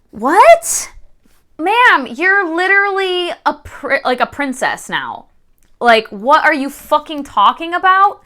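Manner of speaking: high-pitched exasperated telling-off; irritated. Retold or imitated. Reader scolding the heroine for haggling, irritated. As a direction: scold the character as if she could hear, pitch up. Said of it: "I was so fucking irritated with her".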